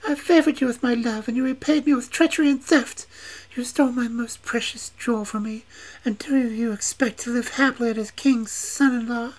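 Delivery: betrayed witch accusing the prince; vengeful witch maiden. A narrator's reading. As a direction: scold him high and loud for his betrayal.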